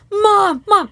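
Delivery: put-on high, loud child calling Mom; kid calling mom. Son calls mom after the find, high and loud.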